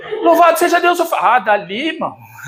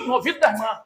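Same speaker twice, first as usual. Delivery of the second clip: usual speaking voice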